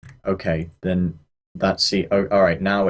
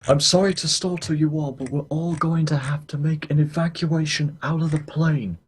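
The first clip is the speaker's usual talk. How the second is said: higher, formal captain on the intercom; pilot announcement voice; 8 semitones higher than usual. Pretend. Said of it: "talking a pilot voice".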